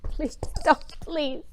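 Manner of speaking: hammed-up, high-pitched begging; exaggerated desperate plea with loud bursts; mock pleading. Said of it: "obviously pleading for his life".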